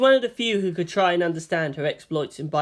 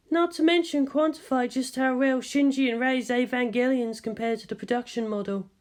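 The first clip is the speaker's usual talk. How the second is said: higher, composed scientist voice; Ritsuko's voice; 7 semitones higher than usual. A narrator's reading. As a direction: speak as the measured senior scientist.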